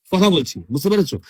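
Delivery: ordinary talk, nothing marked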